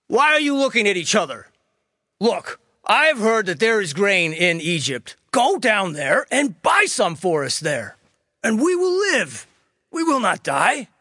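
a hint of an anxious old patriarch; pleading father; Jacob. A narrator's reading. Jacob urging sons to Egypt.

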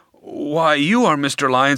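ordinary talk, nothing marked

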